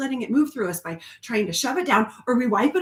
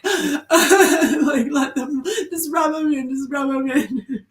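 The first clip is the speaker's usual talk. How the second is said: laughing half-crying, slow and high; laughing demo; 5 semitones higher, 3 dB louder, slower than usual. Shown as a demonstration. Showing the group how she rubs her tears in, laughing and teary. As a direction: show it through a teary laugh. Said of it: "I typically go like this".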